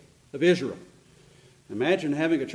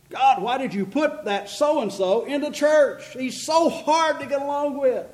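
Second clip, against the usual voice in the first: high-pitched mock grievance; complaining; 8 semitones higher than usual. Church member complaining to God about so-and-so, high mock whine. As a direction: plead a petty complaint, pitched well up. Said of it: "we're complaining about God".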